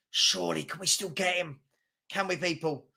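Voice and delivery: soft, deflated appeal; hushed and disheartened; dejected plea. Real, not acted. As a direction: ask quietly, hope draining away. Dejected plea after bad transfer news.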